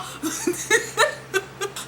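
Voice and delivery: high burst of laughter. Real, not acted. Laughs at own bit.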